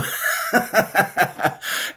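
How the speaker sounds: high breathy burst of laughter. Real, not acted. Laughing at his own teasing, high burst. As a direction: crack up.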